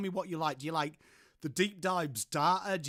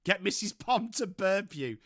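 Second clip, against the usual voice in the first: ordinary talk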